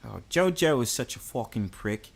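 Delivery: soft put-on London chat; London accent. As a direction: tell the tale as a mock Londoner. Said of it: "should I speak in my London voice".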